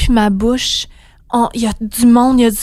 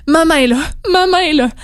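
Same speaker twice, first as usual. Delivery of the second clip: put-on crying out to baby; 6 semitones higher, 3 dB louder, faster than usual. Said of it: "Et là, je crie, puis je pleure".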